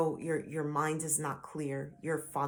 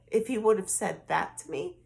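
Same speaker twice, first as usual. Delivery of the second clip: ordinary talk, nothing marked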